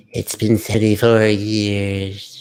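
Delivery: put-on slow, drawn-out old Rose line; old Rose quote. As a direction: stretch it out like an old woman remembering.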